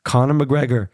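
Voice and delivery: ordinary talk, nothing marked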